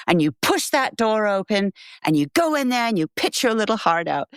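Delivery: put-on admittedly terrible British accent pep talk; Bernard, British accent. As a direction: imitate a British man's encouragement, accent badly done. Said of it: "It's a terrible British accent".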